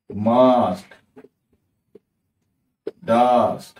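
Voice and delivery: each word called out loud with a long a; model words. Shown as a demonstration. Teacher calling out model words to students, loud and drawn out. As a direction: sound out সান কাপ মাঠ মাস্ট ডাস্ট slowly, one by one. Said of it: "এরকম দেখো".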